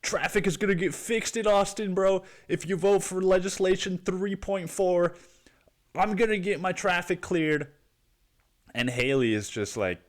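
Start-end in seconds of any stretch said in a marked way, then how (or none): 0.0-7.7 mock naive voter. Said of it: "you're like".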